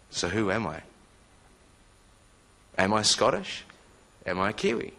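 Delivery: ordinary delivery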